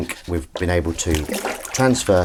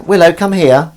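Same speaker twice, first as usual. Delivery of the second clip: loud, high call by name; raised calling voice; calling a pet; 10 semitones higher, 11 dB louder than usual. Real, not acted. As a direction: summon the cat sharply.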